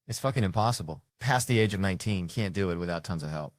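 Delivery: usual speaking voice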